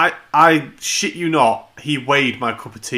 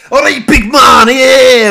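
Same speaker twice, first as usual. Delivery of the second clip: loud, high, boisterous greeting yell; imitated shout; 10 semitones higher, 12 dB louder, slower than usual. Imitating Sam coming up to an anxious dog, shouting. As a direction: bellow it like an over-eager visitor. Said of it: "you just fucking shouted at him".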